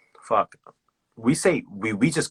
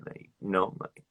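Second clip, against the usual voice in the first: normal talking voice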